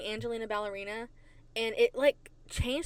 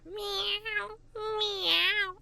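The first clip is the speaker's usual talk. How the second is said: high, drawn-out meows; weird meow; 10 semitones higher than usual. Pretend. Host signing off to her listeners, weird meow. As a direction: mew like an odd cat. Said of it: "I don't know why I'm meowing weird".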